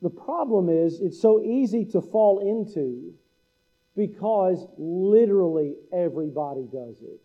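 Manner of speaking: normal talking voice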